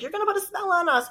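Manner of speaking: high, quick mock accusation; alarmed stranger. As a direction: imitate a panicked woman accusing someone of witchcraft.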